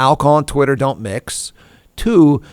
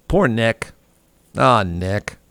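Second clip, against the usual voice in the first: ordinary talk, nothing marked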